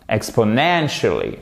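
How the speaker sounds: "Exponentially" with punched NEN, falling after; stress on NEN. Shown as a demonstration. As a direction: hit third syllable of "Exponentially". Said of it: "où se trouve l'accent tonique".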